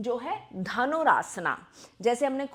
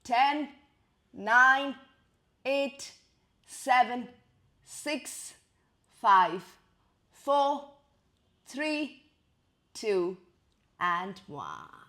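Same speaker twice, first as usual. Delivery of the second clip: paced breath count; 3 semitones higher than usual. Shown as a demonstration. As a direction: count the class through a held pose. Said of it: "मैं counting करती हूँ". (हर अंक के बाद ठहरकर धीमी गिनती)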